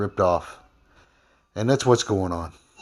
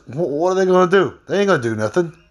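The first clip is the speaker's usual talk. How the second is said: retold defiance; 7 semitones higher than usual. Retelling his scoff at the company's threats, raised defiant voice.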